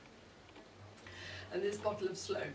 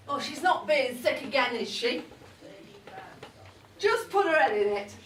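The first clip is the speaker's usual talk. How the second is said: loud, higher, fed-up calling across the room; exasperated call-out; 5 semitones higher, 10 dB louder than usual. Real, not acted. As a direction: call over to the family, exasperated. Calling out about sick daughter-in-law.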